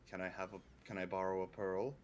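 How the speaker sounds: ordinary talk, nothing marked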